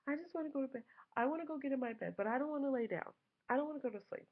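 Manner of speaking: put-on high whining child voice; whiny kid. Son refusing to lie down, high whine. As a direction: plead like a sulky little boy. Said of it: "now he's becoming belligerent".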